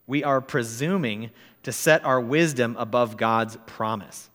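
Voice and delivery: normal talking voice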